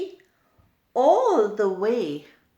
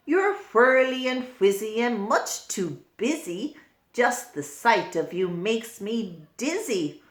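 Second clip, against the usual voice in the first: go-away bird voice; 3 dB louder than usual. Acted. Reader doing the grumpy go-away bird for children. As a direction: shoo everyone off, grumpy little bird. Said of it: "what do you think she said".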